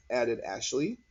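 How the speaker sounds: ordinary delivery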